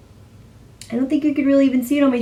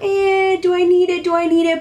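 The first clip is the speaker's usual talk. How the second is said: wavering self-questioning; 8 semitones higher, 3 dB louder than usual. Retold or imitated. Shopper debating with herself over a limited edition palette, high-pitched and hesitant. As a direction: voice your own indecision in a higher pitch. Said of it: "and I'm like".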